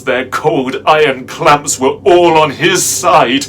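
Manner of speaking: loud, high, intense dramatic narration; desperate climax. A narrator's reading. Narrator with back against barred doors, desperate climax. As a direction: cry it out at full pitch, cornered and defiant.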